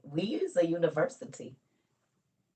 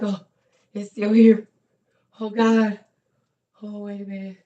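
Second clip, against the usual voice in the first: relieved surprise; 7 semitones higher, 12 dB louder than usual. Retold or imitated. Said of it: "go look at my hair like".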